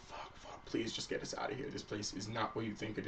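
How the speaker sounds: put-on hushed pleading whisper of frightened kids; urgent whispered begging; whispered plea. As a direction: breathe it out like a scared child begging. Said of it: "They're just sobbing".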